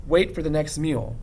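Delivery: ordinary delivery, nothing marked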